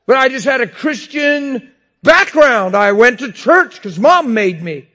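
high, loud fervent preaching; preaching at full force. Acted. Pastor preaching hard about his marriage, loud and high. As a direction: drive it home to the crowd.

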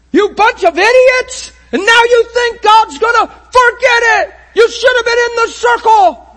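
high, loud, furious tirade; angry scolding. Pretend. Hypothetical Peter berating the repentant crowd, furious shout. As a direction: yell down at them as a self-righteous apostle. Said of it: "he didn't say".

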